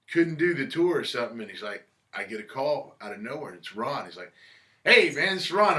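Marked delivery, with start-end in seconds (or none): 4.8-5.8 high, loud, hearty phone greeting; imitating Ron calling him out of the blue. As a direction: announce yourself like an old pal.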